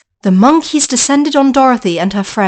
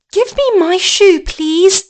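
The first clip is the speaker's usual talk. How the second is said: put-on pleading girl; 8 semitones higher, slower than usual. Dorothy pleads.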